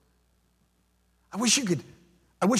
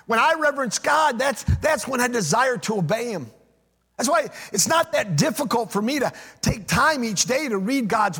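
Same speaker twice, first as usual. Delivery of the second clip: raised, singing preacher cadence, higher and louder; fervent preaching; 6 semitones higher, 3 dB louder than usual. Acted. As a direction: preach it out to the congregation with conviction. Pastor preaching with rising fervour.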